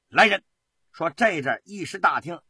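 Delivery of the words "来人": loud, raised summons to guards; imperious shout for attendants; commanding call. A narrator's reading. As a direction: call out an order sharply. Commissioner summons his guards.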